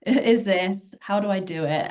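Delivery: normal talking voice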